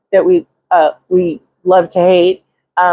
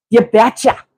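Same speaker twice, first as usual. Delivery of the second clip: a light Minnesota lilt on you betcha; Minnesota grandmother accent; 3 semitones higher than usual. Shown as a demonstration. Imitating her Minnesota grandmother for viewers, light Minnesota accent. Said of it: "my Minnesota grandmother".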